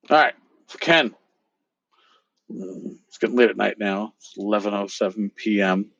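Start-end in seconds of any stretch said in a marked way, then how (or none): none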